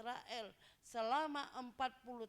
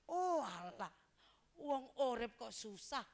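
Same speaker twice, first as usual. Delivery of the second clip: louder, groaning "Oh, alah"; complaining loudly that life is hard; loud exasperated lament; 6 dB louder than usual. Acted. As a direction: throw up your hands in dismay. Acted exasperated sighing complaint.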